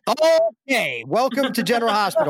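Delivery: a nervous ironic welcome to yourself; wry alarm. Retold or imitated. Reacting to angry Tony Geary.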